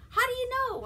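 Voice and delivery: high, loud puzzled question; baffled. Retold or imitated. Jefferson asks Samantha how she knows, high and baffled. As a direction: squeak the question in disbelief. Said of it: "he's like".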